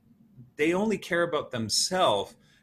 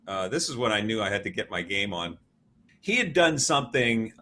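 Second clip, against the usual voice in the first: ordinary delivery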